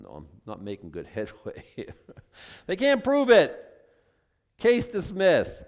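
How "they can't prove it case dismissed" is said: loud, high, emphatic verdict; courtroom declaration. Acted. Preacher acts out a case thrown out of court, declaring it loudly. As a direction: declare it loudly, pitched well up. Acting out Paul's courtroom defence.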